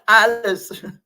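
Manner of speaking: real giggle into the name, slow; laughing. Real, not acted. Reader cracks up saying a viewer's name. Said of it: "I'm getting hysterical".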